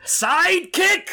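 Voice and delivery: high, slow, loud outraged yell; outraged shout. Acted. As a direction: explode at the word. Guy outraged at the offer.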